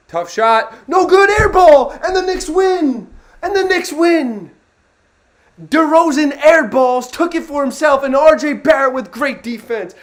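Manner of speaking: high, loud play-by-play yell as the shot misses; excited yelling. Real, not acted. Fan screaming the final play to his stream, ecstatic. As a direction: scream the call like the game just ended. Knicks win on an air ball.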